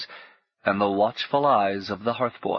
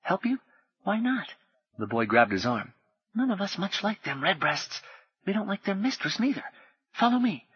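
put-on much higher boy's voice, hushed and quick; urgent potboy; 10 semitones higher than usual. Potboy warning Paul of soldiers, high and hushed. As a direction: warn him like a frightened street boy.

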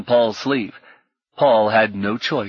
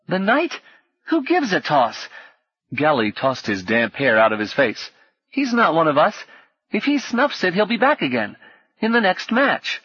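high-pitched dismissive lad; high, careless boyish voice; scornful boy Gally; 7 semitones higher than usual. A narrator's reading. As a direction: scoff as a cheeky street boy.

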